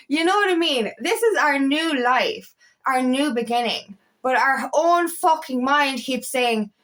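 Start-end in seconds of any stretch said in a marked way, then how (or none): none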